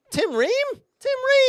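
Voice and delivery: can't believe this pick; incredulous. Real, not acted. Outraged disbelief at pick.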